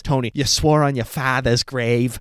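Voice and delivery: a light imitation of a Boston accent. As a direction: quote her in a hint of Boston. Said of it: "with her Boston accent".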